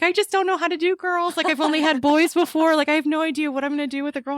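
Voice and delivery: high, fast flustered worrying; fretting mother-in-law. Retold or imitated. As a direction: rattle it off like a panicked grandma. Mother-in-law voiced in story.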